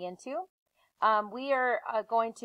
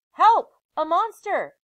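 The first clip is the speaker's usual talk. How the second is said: high, loud cry of alarm; Annie's alarmed shout; 8 semitones higher, 10 dB louder than usual. Acted. Annie shouting to Jack that a monster is coming, loud high cry. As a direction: scream it like a kid spotting a monster.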